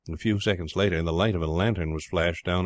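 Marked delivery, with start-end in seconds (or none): none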